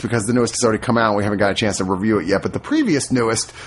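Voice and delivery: ordinary delivery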